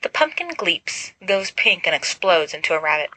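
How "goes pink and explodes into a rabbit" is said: plain reading voice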